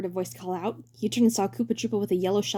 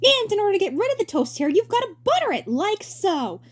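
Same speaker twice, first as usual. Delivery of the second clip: hammy stage actor; 13 semitones higher, 5 dB louder than usual. Acted. Roy presenting.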